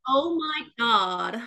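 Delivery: put-on slow, drawn-out American teen accent. Shown as a demonstration. British guest showing how they imitate Americans, American accent. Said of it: "try to sound American".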